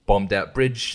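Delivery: ordinary talk, nothing marked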